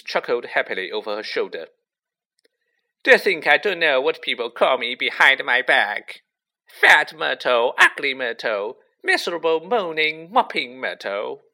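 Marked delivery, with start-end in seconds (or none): 3.0-11.4 loud, raised, aggrieved tirade; hurt outburst. A narrator's reading. Ghost girl lists names used behind her back, aggrieved. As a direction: fling each nickname out bitterly.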